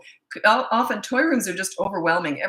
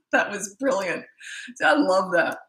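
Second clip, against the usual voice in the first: laughing. Real, not acted. Laughing at son's joke.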